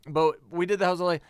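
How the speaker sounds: ordinary talk, nothing marked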